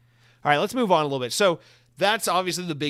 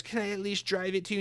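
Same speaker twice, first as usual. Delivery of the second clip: high, put-on pleading voice; pleading underling; 7 semitones higher than usual. CEO asking to drive the coffee over, high pleading. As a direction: beg meekly in a higher mock voice.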